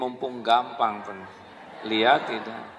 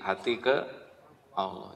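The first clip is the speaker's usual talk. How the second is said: usual speaking voice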